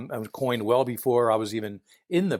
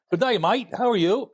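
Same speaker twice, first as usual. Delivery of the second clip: a hammed-up, louder, higher Australian "good day, mate"; mock Australian greeting, pitched up and louder; poor Australian imitation; 9 semitones higher, 4 dB louder than usual. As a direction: lay on a cartoon Australian accent, badly. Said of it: "poor, uh, imitation".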